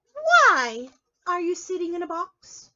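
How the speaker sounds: loud, slow and high, asking the rabbit; loud questioning storybook voice. Acted. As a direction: call the question out slowly to the rabbit. Reading the question aloud.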